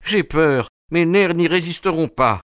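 nervous man on the edge of panic; gasping with fear. A narrator's reading. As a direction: plead in panic, pitched well up. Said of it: "Il hoquetait de peur".

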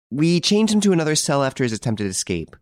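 high-pitched, eager jailer voice; interrupting head jailer. A narrator's reading. Head jailer interrupting to explain to judge, high voice.

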